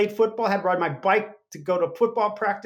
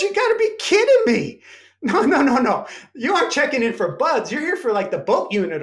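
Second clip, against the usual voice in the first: much higher and louder, laughing in disbelief; 6 semitones higher, 4 dB louder than usual. Retold or imitated. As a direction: scoff at the tiny recruit with laughter. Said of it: "He starts laughing".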